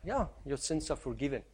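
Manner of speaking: casual, throwaway "Yeah, your sins are forgiven", dropped quietly; breezy, careless forgiveness; offhand. Shown as a demonstration. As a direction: toss it off as if it costs nothing. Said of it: "For us, it's easy to say".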